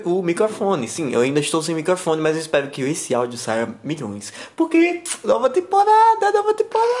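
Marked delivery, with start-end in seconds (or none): 4.5-6.9 high, thrilled announcement; excited. Real, not acted. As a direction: shout it out with joy. Announcing new season excitedly.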